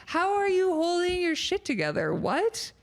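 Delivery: high, stunned disbelief; incredulous. Retold or imitated. Viewer reacting to cheating confession, high and incredulous. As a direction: sound floored by what you just heard. Retelling her own disbelief.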